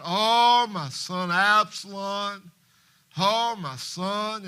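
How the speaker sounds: loud, slow wail at a funeral; hollering in grief. Retold or imitated. Mourner at a funeral hollering for a son, slow wail. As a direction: cry it out long and broken. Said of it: "just holler out".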